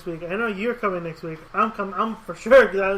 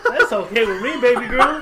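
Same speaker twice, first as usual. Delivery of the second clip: laughing; 3 semitones higher, 7 dB louder than usual. Real, not acted. Teasing wife, laughing.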